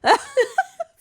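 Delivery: high burst of delighted laughter; high squeal of laughter. Real, not acted. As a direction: shriek with laughter. Laughs at the clip.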